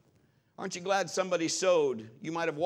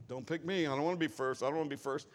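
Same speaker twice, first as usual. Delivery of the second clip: reluctant players; 4 semitones lower, 6 dB quieter, faster than usual. Retold or imitated. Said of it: "everybody just stands around like".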